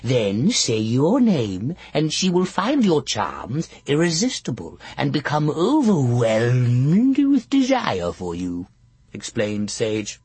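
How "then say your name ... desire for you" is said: put-on slow, croaking old witch; hag voice. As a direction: lean into a crafty old hag. Witch explains the love spell.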